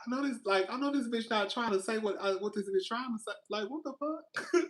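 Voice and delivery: high, soft, fast disbelief; baffled at the customer's words; incredulous inner voice. Retold or imitated. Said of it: "And I'm like".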